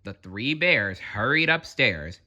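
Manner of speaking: usual speaking voice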